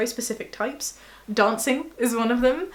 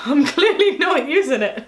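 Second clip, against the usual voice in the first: louder through laughter; laughing; 5 semitones higher, 7 dB louder than usual. Real, not acted. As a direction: laugh at yourself. Laughs at own advice.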